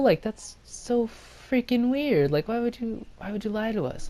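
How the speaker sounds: high-pitched, incredulous protest; retold outrage, voice pushed way up; indignant complaint. Boys confronting girls who lied, high mock indignation.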